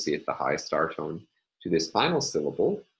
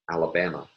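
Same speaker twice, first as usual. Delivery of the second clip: "Alabama" said as a statement, falling at the end; declarative tune; faster than usual. Shown as a demonstration. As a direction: textbook declarative fall on "Alabama". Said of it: "state this word again with the English declarative tone".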